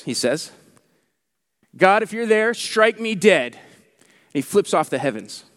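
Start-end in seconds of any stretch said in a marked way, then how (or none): 1.8-3.5 higher, louder mock dare, slowing on strike me dead; defiant challenge. Preacher retelling a gas station heckler daring God, mock loud. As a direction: call out to the sky like a cocky heckler.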